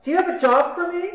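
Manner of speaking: desperate eager question, pitch jumped up; blurted out. Retold or imitated. Nurse blurts to her old classmate, skipping hello, high and eager. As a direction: blurt it out high and loud in one eager burst. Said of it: "She just blurts out".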